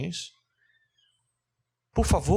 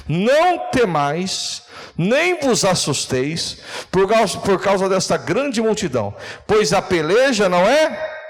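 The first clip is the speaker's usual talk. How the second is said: loud, higher scripture proclamation, drawn out; pregação inflamada; 4 semitones higher, 7 dB louder, slower than usual. Acted. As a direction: proclaim the verse to the crowd, slow and forceful. Lê a Escritura pregando com força. (pregação forte, proclamando o versículo)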